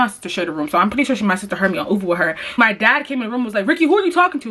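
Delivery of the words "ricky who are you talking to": stern dad. Retold or imitated. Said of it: "Like, very Stern".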